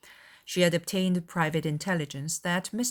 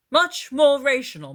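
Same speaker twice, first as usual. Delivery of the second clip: "more" hit hard, louder and higher, mocking; mock echo; 8 semitones higher, 9 dB louder than usual. A narrator's reading. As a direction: parrot her phrase teasingly. Charles echoes her words.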